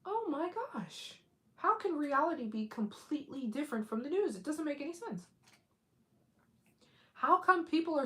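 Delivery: high, astonished wondering; amazed. Retold or imitated. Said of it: "And I was like".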